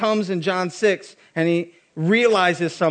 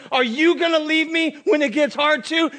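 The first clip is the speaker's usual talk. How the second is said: higher, pushed-out challenge; preached challenge; 6 semitones higher than usual. Acted. Preacher challenging the crowd with Jesus' question. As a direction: put the question to the whole room.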